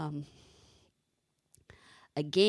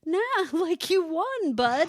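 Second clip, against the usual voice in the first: giggly, higher and louder; laughing reassurance; 11 semitones higher, 6 dB louder than usual. Retold or imitated. Mother quoting herself to her son, laughing. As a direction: laugh while telling him he won.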